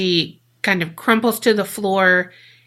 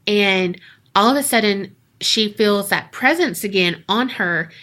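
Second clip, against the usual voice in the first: ordinary delivery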